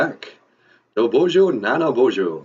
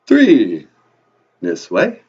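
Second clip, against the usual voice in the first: counting vampire; 2 semitones lower, 8 dB louder than usual. Acted. Count says the English numbers for the host, booming vampire voice. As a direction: announce the number like a theatrical vampire.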